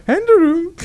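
high, loud send-up of the host; mocking imitation. Teasing the host with how he said the name, high mocking imitation. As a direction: push the name up high in mockery. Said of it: "are you channeling me over there?".

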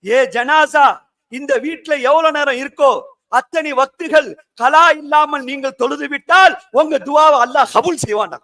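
normal talking voice

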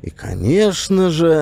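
ordinary delivery

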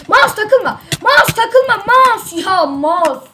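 loud high yells at the mouse; panicked shout. Real, not acted. Gamer shouts high and loud at his freezing mouse. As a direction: beg the frozen mouse loudly, voice up high.

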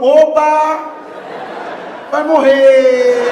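slow, loud threat from the street; stranger's menacing call. Retold or imitated. Stranger on the hill warns him he will die, slow loud threat. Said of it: "alguém falando".

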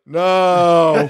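a feeble no through a laugh; half-hearted no. Retold or imitated. Imitating Jeffrey's weak protest, laughing. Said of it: "half-heartedly".

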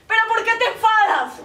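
high, loud protest; pleading outburst. Acted. Woman on the phone protesting to her angry lover, loud. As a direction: cry out the question at him.

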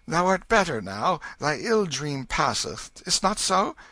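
put-on calm the frightened child; king reassuring his son; comforting king. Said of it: "patted it tenderly".

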